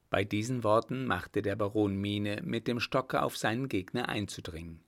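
ordinary delivery

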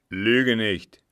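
put-on loud, threatening old captain; fierce rebuke; angry command. A narrator's reading. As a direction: thunder the warning at him. Captain accuses servant of lying.

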